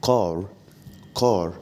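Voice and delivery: «كار» with a rounded English R; English car. Shown as a demonstration. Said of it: "هيبقى اسمها كار".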